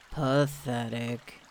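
put-on low, slow, mopey old donkey; gloomy Eeyore. A narrator's reading. Eeyore looking at himself in the stream, gloomy and low. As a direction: sigh the word out like a sad donkey. Eeyore moping at his reflection.

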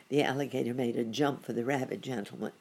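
usual speaking voice

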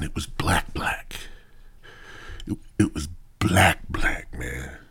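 dropping to a near whisper; low and hushed, drawing them in. Retold or imitated. Hushed build-up in dark.

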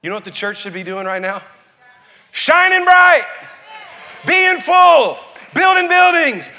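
high, loud, rising sermon shout; fiery revival preaching at full volume; full-force preaching. Acted. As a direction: preach it to the back row, building to a roar.

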